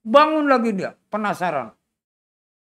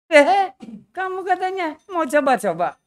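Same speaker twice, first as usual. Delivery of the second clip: taunting chuckle; 12 semitones higher, 7 dB louder than usual. Acted. Dulah taunts the attacker.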